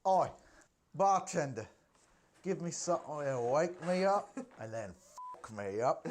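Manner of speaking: a hint of a cheeky British model ordering a drink; Kate Moss impression. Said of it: "That's my Kate Moss impression".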